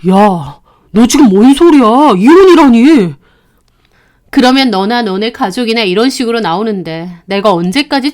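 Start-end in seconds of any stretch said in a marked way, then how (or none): 0.1-3.1 louder, shocked at the word divorce; startled husband. Acted. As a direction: react in alarm to his wife. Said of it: "남편도 놀라더라고요". (깜짝 놀란 남편)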